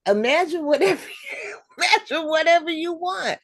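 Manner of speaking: high, loud, fast, breaking into laughter; laughing retelling. Retold or imitated. Retelling a man's comment to the group, laughing. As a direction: laugh helplessly through the quote. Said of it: "I'm laughing because".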